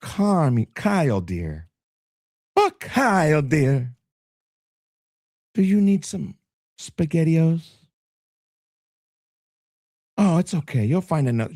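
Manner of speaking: lightly put-on motherly voice; doting mom. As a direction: coo supportively like a fussing mother. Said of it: "then mom's like; She's all supportive".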